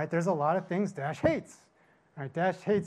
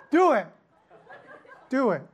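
loud, higher go-ahead; loud decision; 8 semitones higher, 8 dB louder than usual. Retold or imitated. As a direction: blurt out a reckless yes. Resigned owners telling vet.

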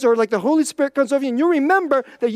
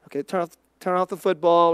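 lightly imitated husband giving in, low; a touch of reluctant compliance; grudging husband; 8 semitones lower, 4 dB quieter, faster than usual. Husband voiced giving in to wife.

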